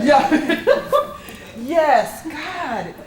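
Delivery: giddy laughing yes; real laughter. Real, not acted. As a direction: laugh it out, a bit overcome. Laughs through overwhelmed reading.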